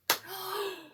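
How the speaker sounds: a sudden high swooning gasp; sharp high gasp. Real, not acted. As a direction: gasp as if the line knocked you over. Reacting to romantic line.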